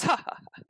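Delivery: breathy unvoiced villain laugh ha ha ha; whispered mocking cackle; creepy cackle. Spider fence cackling over stolen goods, breathy laugh.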